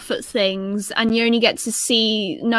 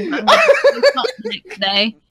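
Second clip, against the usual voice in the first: laughing; 11 semitones higher, 4 dB louder than usual. Real, not acted. Laughing about fear of naps.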